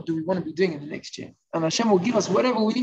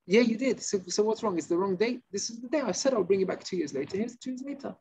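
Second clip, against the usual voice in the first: baffled borrower; 3 semitones higher, 4 dB quieter than usual. Acted. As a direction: act out a confused man defending himself. Borrower protesting he repaid on time.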